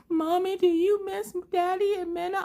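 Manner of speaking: high-pitched imitation of a small child; put-on kid voice, high and pleading; little daughter. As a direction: ask in a tiny, squeaky little-girl voice. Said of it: "And she's like".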